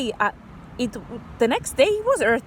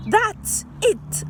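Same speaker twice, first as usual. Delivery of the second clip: sudden high burst, much higher pitch; excited yell; 11 semitones higher than usual. Acted. Child realising how to save polar bears, excited yell. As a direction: eureka shout. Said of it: "I yelled".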